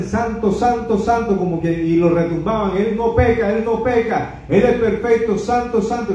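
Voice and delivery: high ringing proclamation of holiness; exalted high chant of the seraphim; angels proclaiming. Retold or imitated. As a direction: cry it out like angels praising God. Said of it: "ángeles que decían".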